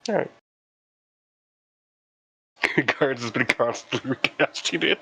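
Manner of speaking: ordinary talk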